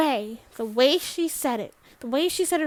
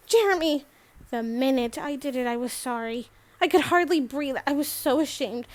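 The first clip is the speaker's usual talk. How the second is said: ordinary delivery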